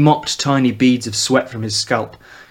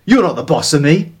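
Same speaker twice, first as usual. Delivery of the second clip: hissed defiance; 4 semitones higher, 4 dB louder than usual. Acted. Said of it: "with a hiss on his tongue".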